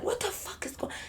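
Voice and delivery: breathless whispered disbelief; stunned whisper. Retold or imitated. Whispering to friends in the car, stunned. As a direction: hiss it under your breath in shock.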